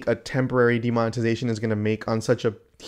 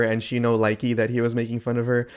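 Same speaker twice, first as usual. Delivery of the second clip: ordinary delivery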